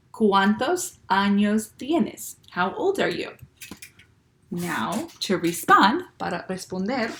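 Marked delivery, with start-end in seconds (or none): 0.1-2.4 very slow, each word spelled out for the class; slow choral model. Shown as a demonstration. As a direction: lead the class slowly, word by word. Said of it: "Todos, say it with me".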